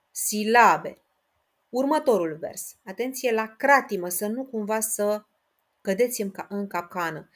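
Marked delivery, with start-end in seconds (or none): none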